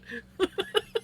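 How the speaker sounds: high, rising giggle; amused laugh. Real, not acted. Laughing at the mix-up.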